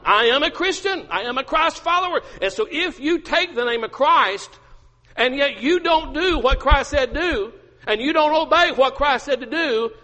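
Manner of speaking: high, forceful pulpit delivery; impassioned preaching. Acted. Preacher challenging those who claim Christ's name, impassioned. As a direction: drive each phrase home loudly.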